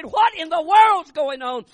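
a high, loud, slow cry of confusion; bewildered shout. Retold or imitated. Husband shouts to his wife to ask what is happening. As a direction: yell out in bewilderment.

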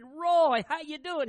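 loud, high call across the yard; a big booming hello; hearty called greeting. Retold or imitated. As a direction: hail him warmly from a distance. Preacher calls out greeting to Roy.

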